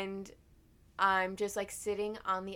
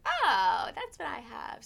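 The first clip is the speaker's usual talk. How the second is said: loud, drawn-out "oh" of discovery; acted realization; 4 semitones higher, 7 dB louder, slower than usual. Reading the diagnosis on the bottle, mock revelation. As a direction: play up the sudden realization. Acts out reading the Pepto label.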